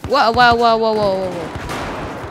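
loud then slow drawn-out wows; marvel, then let it fade; amazed wow. Real, not acted. Amazed at in-game moment.

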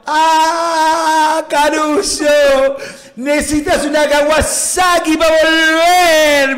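loud, high, drawn-out shout; coach yelling. Retold or imitated. Coach on the bench to slow Caruso, long yelled taunt. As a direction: bellow it from the bench, stretching the words. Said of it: "El técnico en el banco me gritaba".

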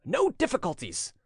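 high-pitched impatient cry; furious. A narrator's reading. Prince overruling his servant, furious. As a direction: cut off the objection, angrily. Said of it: "cried the prince, stamping his foot furiously".

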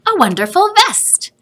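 a grandpa's proud surprise; excited reveal. A narrator's reading. Grandfather proudly reveals the vest to Joseph.

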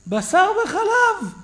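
high, slow, loud alarmed cry; alarmed shout. Retold or imitated. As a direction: cry out the forbidden mix in horror. Father cries out in alarm. (קריאה מבוהלת)

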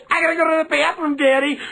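put-on high, fast whining child; whiny kid voice. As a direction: nag like a kid in the back seat. Kids yelling from back seat.

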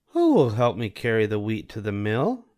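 a lightly voiced hen asking; slight hen lilt; Little Red Hen. A narrator's reading. Hen asking who will carry wheat, lightly voiced. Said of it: "she asked".

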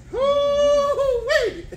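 high, squealing whoop with a laugh; a sky-high laughing woo-wee; laughing falsetto whoop. Real, not acted. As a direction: shriek with delight.

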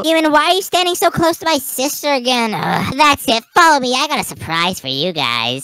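a mom catching them too close, then plotting; stern mom figure. Acted. Mother scolds the kids near her daughter, stern and loud.

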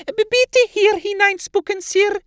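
a tiny elf scolding in a squeak; squeaky house-elf. Acted. Elf Flabbles chides Ron in a high squeak.

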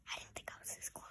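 whisper. Real, not acted. Embarrassed aside into the mirror.

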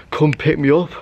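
ordinary talk, nothing marked

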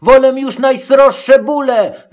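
high, forceful goat protest; high-pitched, emphatic objection; Matołek crying out. A narrator's reading. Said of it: "krzyknął nań koziołek".

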